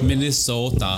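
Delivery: Minnesota accent. Shown as a demonstration. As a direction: stretch the vowels the Minnesota way. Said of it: "Oh, close enough".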